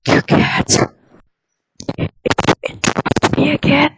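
loud raspy whisper, very slow; creepy whisper. Pretend. Creepy whisper to a friend who keeps interrupting. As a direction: hiss it like a ghost at the bedside. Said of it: "Very lovely A S M R".

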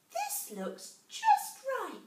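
high, slow squeaky mouse; a tiny mouse gasping in wonder; little mouse voice. A narrator's reading.

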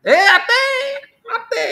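high-pitched protest. Real, not acted. Teacher rejects a student's answer, high protesting no. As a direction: shoot the voice way up to object.

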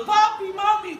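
put-on high, loud child calling for parents; a hint of a happy child crying out. Niños felices llaman a sus padres. (niños gritando papi y mami, voz aguda)

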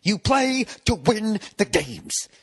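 slow, high imitation of the coach's rant; mock press-conference outburst; Herm Edwards rant. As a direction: pound each word like the angry coach. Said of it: "he just snapped".

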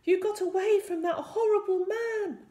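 high, loud relieved queen; relieved cry. A narrator's reading. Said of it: "the Queen cried in relief".

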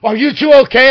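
high, loud shout over the noise; shouted question. Retold or imitated. Bartender rushing over to the two men, shouted question. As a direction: call out over the bar din.

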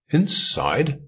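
high, rising astonished question. A narrator's reading. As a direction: genuinely caught off guard. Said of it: "genuinely astonished".

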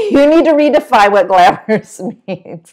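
amused, breaking into laughter; words giving way to giggles; laughing. Retold or imitated. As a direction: laugh through the retort. Laughs at being called glamorous.

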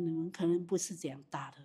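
soft whisper to the audience; whispered aside. Real, not acted. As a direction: let them in on a secret. (小声悄悄说)

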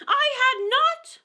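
high, loud, slow angry cry; sharp and angry. Acted. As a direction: snap it out, offended. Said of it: "cried the mouse sharply and very angrily".

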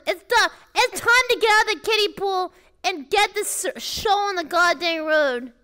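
ordinary delivery

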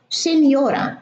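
سينيورا spoken as sinyora, with gn sounded ny; Italian pronunciation. Shown as a demonstration. Teacher modelling the Italian gn sound for students, clear demo. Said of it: "ولما تكون الجي وراها إن تنطق يو".

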